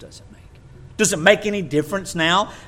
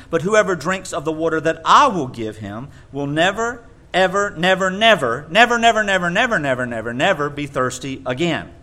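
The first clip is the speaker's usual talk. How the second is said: piled-up "never, never" each one punched louder; over-emphasized nevers; 5 dB louder than usual. Shown as a demonstration. Preacher mocking an over-literal translation, hammering "never". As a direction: hammer every "never" for comic effect. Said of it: "how silly it would sound if we really emphasized".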